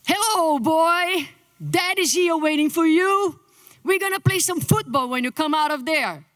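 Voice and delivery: high, excited dad voice to the unborn son; talking to baby in womb. Retold or imitated. Father talking to his unborn son, high and eager. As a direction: coo eagerly to the bump like a proud father. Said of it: "the way you're gonna talk to the baby in the womb".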